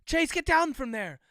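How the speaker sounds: high, loud shouted command; urgent shout. A narrator's reading. Floyd orders Chase down from the girder, shouting. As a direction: call up to someone in danger. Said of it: "Floyd shouted".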